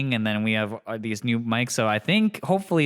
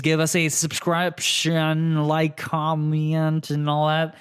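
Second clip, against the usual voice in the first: slow, stretched-out list; drawn-out plug; 4 semitones higher, slower than usual. Real, not acted. Podcast host asking listeners to subscribe, drawn-out plug. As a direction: drag each word out playfully.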